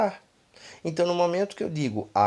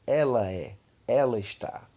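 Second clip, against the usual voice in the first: usual speaking voice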